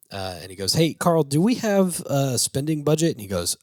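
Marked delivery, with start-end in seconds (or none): 0.7-3.1 raised, louder hail to a coworker; acted eager question, loud at the start; retold calling out. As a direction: call over to a buddy, pitched up. Acts out calling to Carl.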